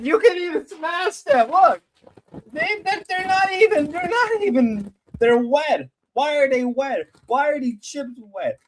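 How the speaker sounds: squeaky disgusted whine; high-pitched whine. Real, not acted. Streamer shows chat the soggy chips with a high whine. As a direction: complain way up high.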